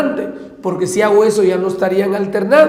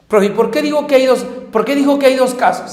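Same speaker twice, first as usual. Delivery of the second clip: higher, faster eager student voice; student asking; 5 semitones higher, faster than usual. Acted. Teacher acts out a student asking the professor why. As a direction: play the curious pupil butting in quickly.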